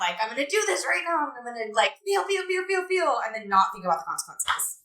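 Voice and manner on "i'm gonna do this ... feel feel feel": high-pitched, giddy imitation of impulsiveness; high mock-giddy chant; mock impulsive excitement. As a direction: act out a reckless rush, pitched way up. Voices an impulsive thrill-seeker's thoughts.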